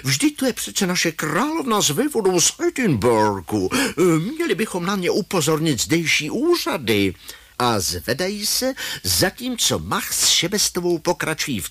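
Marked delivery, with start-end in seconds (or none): 0.0-7.1 high-pitched, put-on tourist wife; English tourist lady. A narrator's reading. English tourist whispering to her husband, high put-on voice. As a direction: voice her much higher, whisper only pretended. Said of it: "anglická turistka šeptá manželovi".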